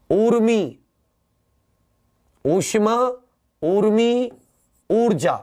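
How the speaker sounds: ऊर्मी, ऊष्मा, ऊर्जा with the ऊ held long and high; long ऊ sound. Shown as a demonstration. Teacher to students, stretching the long ऊ clearly. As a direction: stretch the opening ऊ of each word. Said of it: "उच्चारण लंबा करो".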